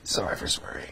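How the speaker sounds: whispered apology; whispering. Real, not acted. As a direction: drop to a whisper.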